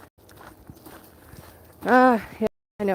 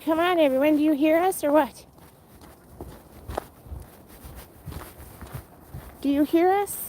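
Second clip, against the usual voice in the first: higher, calling out to the stream chat; impatient call to the stream viewers, voiced higher; mock impatient friend; 6 semitones higher than usual. Quoting a friend asking viewers, higher mock call. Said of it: "She's like".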